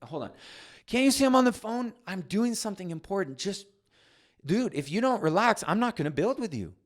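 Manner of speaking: put-on high, loud angry snap at the kid; a stressed dad losing his temper; snapping. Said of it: "I snap".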